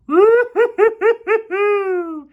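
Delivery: loud high whooping laugh; screaming with laughter; excited laugh. Real, not acted. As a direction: lose it laughing at the play. Laughs at a big block.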